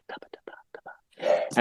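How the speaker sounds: breathy unvoiced consonant pops; whispered percussive syllables. Shown as a demonstration. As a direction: whisper a soft run of puffs. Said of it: "give it a percussion".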